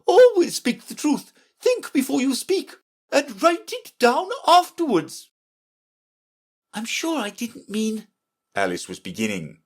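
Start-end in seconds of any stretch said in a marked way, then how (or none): 0.0-5.3 very high, commanding queen; imperious Red Queen. Acted. Red Queen instructing Alice, high and imperious. 6.7-8.1 high, soft protest; apologetic Alice. Acted. Alice to the Red Queen, soft apology.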